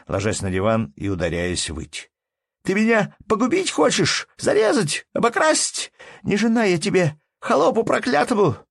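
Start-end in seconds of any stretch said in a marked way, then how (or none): 2.6-8.6 very high, loud shrieked tirade; hysterical wailing. A narrator's reading. Wife on the divan screaming at husband, hysterical wail. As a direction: shriek each accusation in hysterics. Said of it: "завопила Анна Андреевна".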